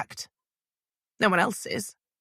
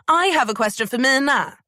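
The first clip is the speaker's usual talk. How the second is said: raised voice calling across the crowd; 7 semitones higher, 5 dB louder than usual. A narrator's reading. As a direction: call out over the press pack. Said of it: "she called out".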